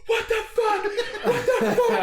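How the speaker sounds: very high, hysterical mock wail; screaming and crying impression. Storyteller imitating his pranked friend, screaming and crying. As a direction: imitate a friend shrieking in tearful panic. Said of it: "He's, like, screaming and crying".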